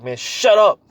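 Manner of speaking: loud, high snap of a mock rebuke; annoyed rebuke. Yahushua woken from sleep, snapping at the storm loudly. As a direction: bark it out, fed up at being woken.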